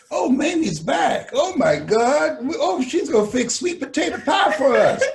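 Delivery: hammed-up roach voices; cheering roaches. Roaches cheering mama's return, high and loud.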